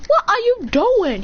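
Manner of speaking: high, drawn-out swooping question; suspicious challenge. Acted. Hashirama catching him sneaking off, suspicious challenge. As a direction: sing out the question high and slow.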